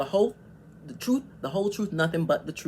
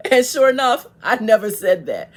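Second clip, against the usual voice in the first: much louder, laughing vindication; triumphant; 5 semitones higher, 12 dB louder than usual. Real, not acted. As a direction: crow that you were right all along. Proved right after replaying video.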